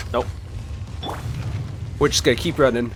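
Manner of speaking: normal talking voice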